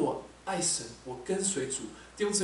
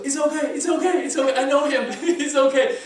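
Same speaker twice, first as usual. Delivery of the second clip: high, loud repeated it's okay; urgently calling forward to calm him, laughing; frantic reassuring call; 11 semitones higher, 11 dB louder than usual. Retold or imitated. Calling to panicking coworker on plane. (高声急切地安慰惊慌的同事)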